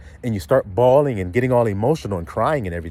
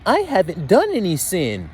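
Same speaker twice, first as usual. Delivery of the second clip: higher put-on voice of denial; adulterous woman; 5 semitones higher than usual. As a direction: play a woman denying her sin. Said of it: "An adulterous woman".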